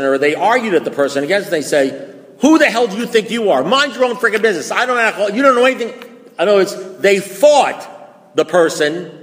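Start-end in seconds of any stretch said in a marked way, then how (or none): 2.4-5.9 higher, louder mock outrage; retold angry yell. Denying alcoholic snapping at whoever names the drinking, mock angry. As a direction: play the drunk yelling back at a friend. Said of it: "they yelled at the person".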